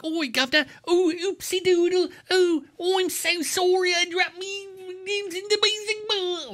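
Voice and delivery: high, hammed-up Cockney bumbler; mocking Cockney accent. Mocking the actor's silly Cockney bit. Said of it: "there's so many scenes where he's like".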